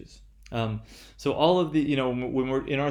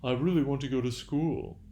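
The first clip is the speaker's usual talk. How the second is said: mock eager voice, slowing and softening on "oh yes"; ironic imitation; 2 semitones higher than usual. Lecturer mocking a reluctant student to show irony, ironic mock eagerness. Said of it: "I'm sort of imitating someone".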